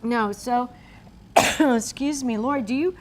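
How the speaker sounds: usual speaking voice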